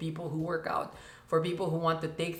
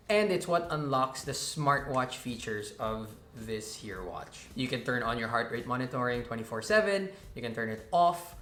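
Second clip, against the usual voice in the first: normal talking voice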